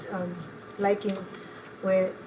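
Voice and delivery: usual speaking voice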